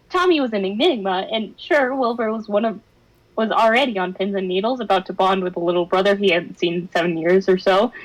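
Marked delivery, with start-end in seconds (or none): none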